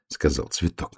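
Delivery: ordinary delivery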